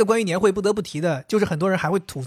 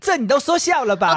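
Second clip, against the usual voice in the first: put-on higher, louder Taiwanese accent; 6 semitones higher, 4 dB louder than usual. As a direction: Taiwanese accent, light and teasing. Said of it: "台湾腔你都是".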